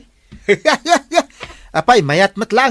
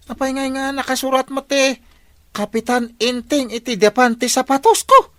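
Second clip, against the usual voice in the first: high-pitched, baffled questioning; indignant disbelief; 11 semitones higher than usual. Acted. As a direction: protest in a raised, incredulous pitch. Captain baffled at writing on shoes. (masmasdaaw nga agsaludsod)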